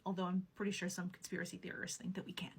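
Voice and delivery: soft conspiratorial whisper; whispered aside. Real, not acted. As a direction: lean in and whisper the joke. Wry aside about conspiracy theorists.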